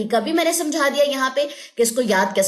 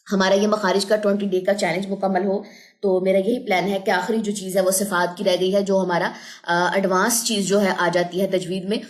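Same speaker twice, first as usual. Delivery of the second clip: ordinary talk, nothing marked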